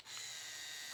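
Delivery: a breathy shocked gasp; sharp gasp. Retold or imitated. Friend gasps as the clippers slip, shocked gasp. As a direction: gasp as if you just ruined something. Barber friend realizes mistake.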